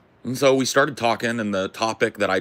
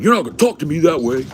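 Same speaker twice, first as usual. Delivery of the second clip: high, loud, indignant; hammed-up outraged retort; mock angry warning; 7 semitones higher, 7 dB louder, faster than usual. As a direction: snap it like a furious guy setting rules. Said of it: "And he starts going".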